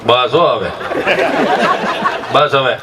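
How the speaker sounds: very low, curt paternal order; deep-voiced dad's awkward hushing; father hushing child. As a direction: order a crying child to stop, deep and short. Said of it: "બાપો સાનો રાખે".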